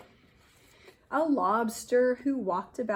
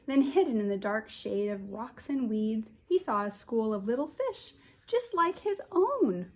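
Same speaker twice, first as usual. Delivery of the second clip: storybook narration; 3 semitones higher than usual. A narrator's reading. Teacher reading picture book aloud.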